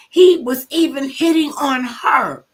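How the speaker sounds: high, loud, slow outburst; outraged. Real, not acted. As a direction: cry out in disbelief. Outraged retelling of betrayal.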